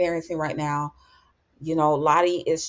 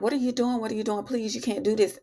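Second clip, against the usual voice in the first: begging; 5 semitones higher, faster than usual. Retold or imitated. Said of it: "he does start begging for his life".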